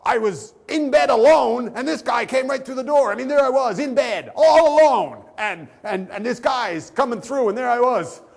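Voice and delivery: high, loud hysterical protest; mock outraged scream, dragging out key words. Hotel guest protests to night desk guy, screaming. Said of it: "starts screaming like".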